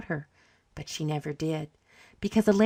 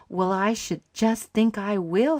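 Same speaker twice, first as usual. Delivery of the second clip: slow, "just think I will" leaned on and pitched up; wholehearted slow, higher-pitched yes; emphatic; 4 semitones higher, slower than usual. A narrator's reading. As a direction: land hard on "I will", drawing it out. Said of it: "said Charlotte emphatically".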